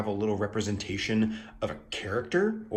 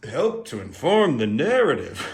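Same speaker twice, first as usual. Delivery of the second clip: higher, swooping parody of own phrase; hammed-up, sing-song emphasis on "inform" and "narrative"; mock-dramatic echo; 4 semitones higher, 4 dB louder than usual. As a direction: repeat it grandly with big pitch swoops. Repeats own phrase in mock style.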